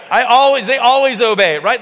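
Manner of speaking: loud, high-pitched bragging; boastful parent. Acted. Parent showing off about kids who obey, loud boasting. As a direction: brag it loudly, pitched well up.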